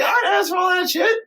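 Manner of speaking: hammed-up high teary yell; crying shout. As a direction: wail it like a fed-up wife. Padme's outburst.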